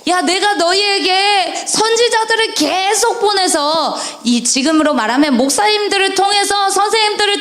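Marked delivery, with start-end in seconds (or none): 0.1-4.0 raised voice of God rebuking; a touch of a stern God; God speaking. Acted. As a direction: speak as God, higher and pressing. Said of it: "하나님께서 말씀하셨어요".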